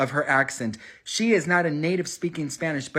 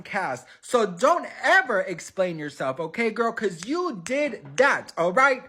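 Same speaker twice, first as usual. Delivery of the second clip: excited sassy hype; 7 semitones higher than usual. Real, not acted. Gushing support to Selena, pitch up.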